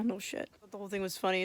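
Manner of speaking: ordinary talk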